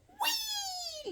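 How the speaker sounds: soft, very high squeal; one long thin squeaky wail; crying toy lion. Pretend. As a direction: pretend whimper in tiny falsetto. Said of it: "Then Lion go".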